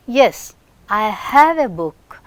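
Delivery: "have" slowed and leaned on, full form; "have" said whole and pressed, sentence slow; stress on have. Shown as a demonstration. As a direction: put the weight on "have" in full form, not "'ve". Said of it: "At that time, have".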